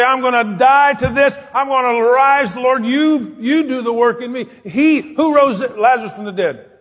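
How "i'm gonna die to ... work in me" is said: loud, raised-pitch preacher's resolve; rousing declaration. Acted. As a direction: declare it forcefully as a vow to the Lord. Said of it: "you can rise up and say".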